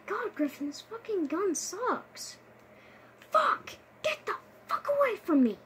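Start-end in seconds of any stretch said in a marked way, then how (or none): 3.3-3.7 yelped curse, high and loud; sharp shout. Real, not acted. Startled by enemy in game. 4.0-5.6 high, loud shout; panicked yell. Real, not acted. Fighting enemies in game.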